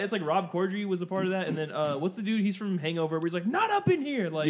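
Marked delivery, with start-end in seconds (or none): 3.5-4.3 mock protest in a very high voice; high-pitched comic quote. Quoting an actor's funny line to friends, high-pitched imitation.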